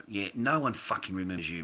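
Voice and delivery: ordinary talk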